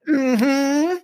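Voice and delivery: high, drawn-out "mm-hmm"; mock high-pitched hum of agreement; imitated audience mm-hmm. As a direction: hum approval like an eager audience member. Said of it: "A lot of".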